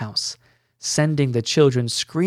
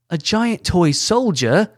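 higher, louder exclaimed shout; 6 semitones higher, 6 dB louder than usual. A narrator's reading. Glint answering Ella's question, shouting. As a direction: call it out in alarm. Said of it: "Glint shouted".

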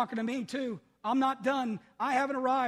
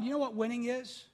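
ordinary delivery

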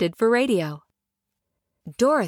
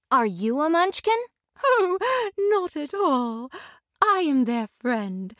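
tender lady; 10 semitones higher than usual. Acted. Glinda tenderly welcoming Dorothy to Munchkin Land. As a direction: welcome her gently and graciously.

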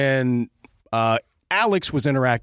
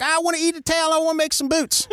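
put-on hillbilly accent. Skeeter on the alligator asking for duct tape, hillbilly accent. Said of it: "I'm just gonna use an accent".

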